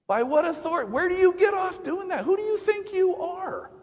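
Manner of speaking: high, incredulous demand; indignant challenge. Retold or imitated. Preacher voicing the priests challenging Jesus, indignant high demand.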